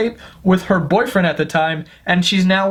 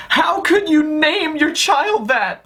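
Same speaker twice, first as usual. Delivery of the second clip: mock outraged parent-critic; 7 semitones higher, slower than usual. Mocking outraged public.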